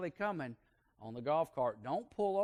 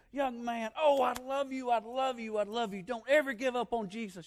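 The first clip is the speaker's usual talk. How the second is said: put-on higher and louder, gushing old aunt's blessing; loving old aunt; 8 semitones higher, 5 dB louder than usual. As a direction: pour out love on the boy like a doting old aunt. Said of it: "She went over and she say".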